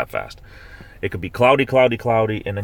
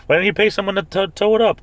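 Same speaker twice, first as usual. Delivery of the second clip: incredulous question; 11 semitones higher, 4 dB louder than usual. Real, not acted. Incredulous about cousin's long drive.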